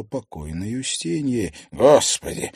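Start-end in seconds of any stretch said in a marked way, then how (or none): 1.7-2.5 exclaiming. Acted. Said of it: "воскликнул Макарский".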